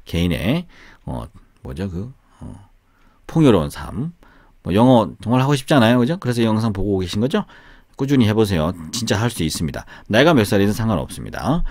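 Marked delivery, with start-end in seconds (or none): none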